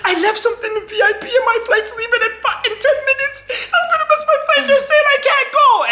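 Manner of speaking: put-on high, frantic girl's voice, laughing through it; imitating a panicked girl. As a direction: squeal it like a frantic traveller about to miss her flight. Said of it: "She's like".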